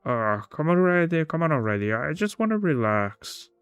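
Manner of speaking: a mild wheedle to a companion; lightly voiced impatient guest. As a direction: coax a reluctant friend, a touch impatient. Voices the guest urging Gaston.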